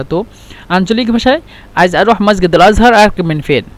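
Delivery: usual speaking voice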